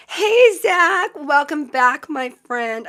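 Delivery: bright, loud, high welcome; excited greeting. Real, not acted. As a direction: call out happily to a friend. Excited greeting to returning chatter.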